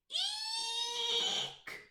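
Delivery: piercing high owl screech; very loudest eek. A narrator's reading. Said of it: "let out his very loudest".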